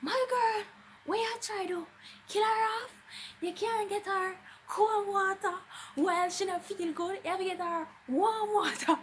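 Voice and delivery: high, loud Jamaican driver voice, scolding; mock-scold like a fussy cab driver; imitating a loud, high-pitched scolding taxi driver. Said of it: "the driver of the taxi ... was all like".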